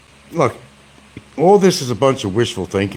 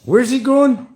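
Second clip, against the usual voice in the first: high put-on voice; 4 dB louder, slower than usual.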